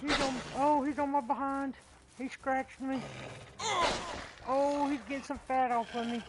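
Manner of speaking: high, loud frantic yelling; panicked shouting. Real, not acted. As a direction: scream while being chased. Wolf attacking, screaming.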